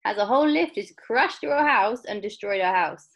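loud, indignant question starting high; unhappy grandma. Acted. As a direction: demand it, stretching the first words. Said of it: "grandmas is not looking so happy".

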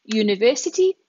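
normal talking voice

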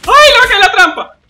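very high loud thrilled shriek; excited shriek. Real, not acted. Streamer shrieks as the trap is about to hit the enemy. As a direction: shriek with glee.

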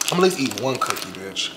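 normal talking voice

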